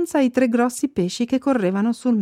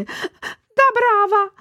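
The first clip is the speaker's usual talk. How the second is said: desperate crying; 12 semitones higher than usual. A narrator's reading. Aunt Ply weeping and begging the frog-girl to free her nephew. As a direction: beg through tears, very high. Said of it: "cominciò a piangere disperata".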